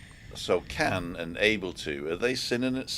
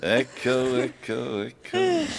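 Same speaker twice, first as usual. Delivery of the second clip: cave echo; 5 semitones higher, slower than usual. Shown as a demonstration. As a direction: echo effect, fading repeats. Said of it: "echo on you as well".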